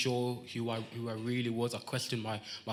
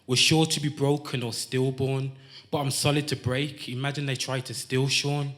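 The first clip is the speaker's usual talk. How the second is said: punchy, rhythmic performance poetry, louder than chat; spoken-word poem; 7 dB louder than usual. Acted. Poet opens his piece to the crowd, spoken-word delivery. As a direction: land each phrase like a poetry slam.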